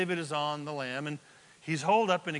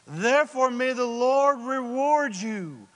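slow, high, loud declamation; dramatic blessing; 6 semitones higher, 6 dB louder than usual. Acted. Saul blesses David for sparing him, declaimed loudly. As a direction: draw out the blessing, projecting it grandly. Reading tearful Saul's blessing aloud.

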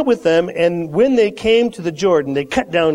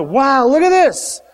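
excited; 6 semitones higher than usual. Acted. Prophets marvel at their growing crowd.

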